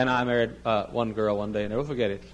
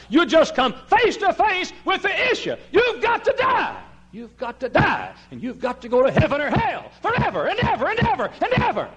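full-force preaching; 4 dB louder than usual. Real, not acted. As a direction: preach it with urgent, soaring force.

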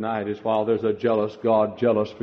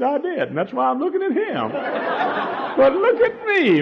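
laughing. Retold or imitated. Wife laughs while answering husband.

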